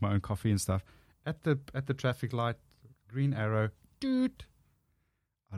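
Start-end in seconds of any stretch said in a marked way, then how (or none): 4.0-4.4 high short honk sound; car horn doot. Retold or imitated.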